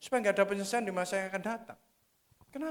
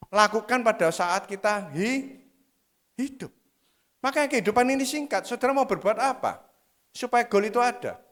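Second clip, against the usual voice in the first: preaching at full force; 3 semitones higher, 12 dB louder than usual. Acted. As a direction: preach it hard, hammering each bukan. Khotbah penuh semangat. (khotbah berapi-api dengan tekanan keras)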